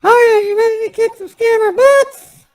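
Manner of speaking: put-on high girly voice-changer voice; chirpy high woman's voice, loud and bright; Mary voice. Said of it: "we let Mary start it off".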